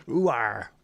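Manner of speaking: drawn-out West Country "ooh arr"; West Country accent. Shown as a demonstration. Streamer showing West Country speech, West Country accent. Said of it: "they do in the West Country".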